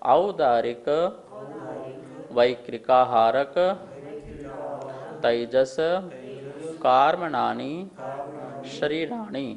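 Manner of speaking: chanted sutra recitation, word by word with pauses; leading a sutra chant; slow recitation. Shown as a demonstration. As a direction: recite each word slowly for the class to repeat. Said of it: "एक बार पुनः".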